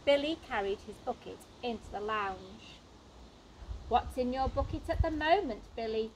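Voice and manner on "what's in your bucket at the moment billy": ordinary delivery, nothing marked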